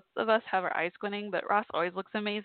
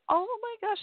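excited gush; 13 semitones higher than usual. Real, not acted. Gushing about the young contestant.

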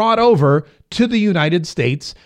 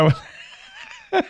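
laughing; 5 dB quieter than usual. Real, not acted. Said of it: "laughs at his own clip".

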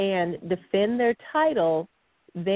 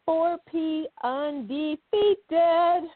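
high, slow, drawn-out announcer call; stretched-out hype announcer voice; 8 semitones higher, much slower than usual. Acted. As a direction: boom out the event name like a ring announcer. Host hypes the event name.

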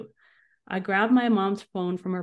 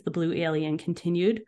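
ordinary delivery, nothing marked

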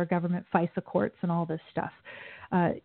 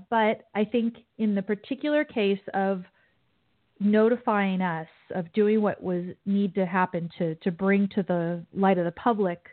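ordinary talk, nothing marked

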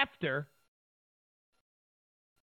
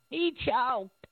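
mock Scarborough; 8 semitones higher than usual. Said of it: "Joe Scarborough".

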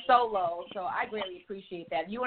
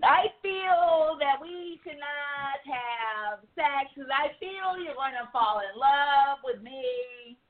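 high, slow put-on voice of a needy man; mock clingy man; 6 semitones higher, slower than usual. Sandy mimicking a man who'd fall for her, slow and high. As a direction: ham up a lovesick man begging, slow.

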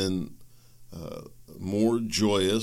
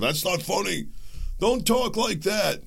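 scolding friend; 10 semitones higher than usual. Retold or imitated. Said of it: "Somebody said".

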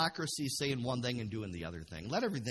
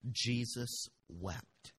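ordinary delivery

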